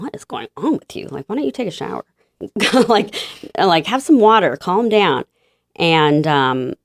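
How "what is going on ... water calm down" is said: lightly voiced husband, pitched up; high-pitched retold exasperation; imitated bewildered husband. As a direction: act out a baffled husband trying to settle his wife. Imitating husband reacting to her meltdown.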